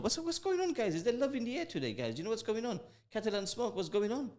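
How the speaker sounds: sing-song high "what's going on, guys"; playful high-pitched teasing. Real, not acted. Host teases viewers about the romantic mood, squeaky high pitch. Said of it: "I'm gonna get a bit soppy tonight".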